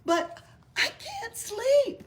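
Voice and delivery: high, slow, pleading gecko voice; whiny little gecko. Acted. The gecko begging the village boss, high and whiny. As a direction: drag it out like a sleepless kid lizard.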